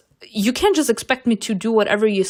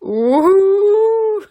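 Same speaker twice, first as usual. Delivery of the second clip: loud, high rising "ooh"; excited squeal; 11 semitones higher, 6 dB louder than usual. Real, not acted. Player reacting to his voice in her ear, squealing. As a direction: swoop up in a long fangirl squeal.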